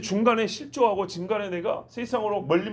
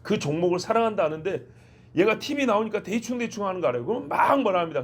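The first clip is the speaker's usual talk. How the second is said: usual speaking voice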